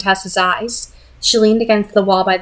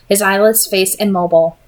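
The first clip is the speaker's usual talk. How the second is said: usual speaking voice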